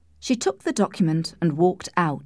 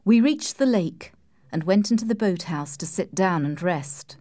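usual speaking voice

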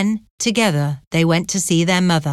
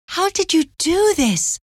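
very high-pitched astonished question; disbelief; 12 semitones higher than usual. A narrator's reading. Anne is shown her brother's pardon, in disbelief. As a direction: ask it high, unable to believe it. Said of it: "Anne couldn't believe it".

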